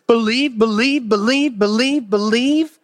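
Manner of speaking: BELIEVE repeated in an even, rolling rhythm; insistent repetition. Shown as a demonstration. Preacher tallying the chapter's repeated word, insistent repetition. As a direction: drum out BELIEVE, each with the same lilt.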